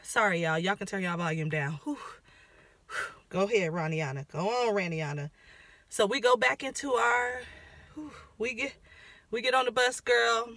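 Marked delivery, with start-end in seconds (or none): 0.0-5.3 dropped low and soft, calming down; lowered voice. Real, not acted. Reining herself in after ranting at viewers, lower and softer. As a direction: pull it back, speaking lower and softer. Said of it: "let me lower my voice".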